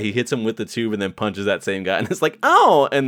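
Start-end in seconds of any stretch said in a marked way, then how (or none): 2.4-2.9 very high loud ow; hammed-up pained ow; mock yelp. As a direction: squeal it out. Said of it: "literally says like that".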